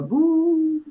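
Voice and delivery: long, high, drawn-out "boo"; ghostly boo. Shown as a demonstration. Host showing co-host the stylized title, spooky drawn-out "boo". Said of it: "now it's stylized, so there's a little bit more".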